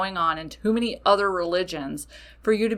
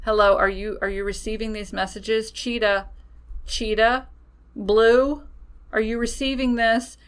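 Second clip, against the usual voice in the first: playful, louder spirit calling down a bad line; spirit guides testing the line; 3 semitones higher, 5 dB louder than usual. Spirit guides calling the witch, loud and playful. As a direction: spirits checking if the line is open. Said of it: "they almost could be testing the line".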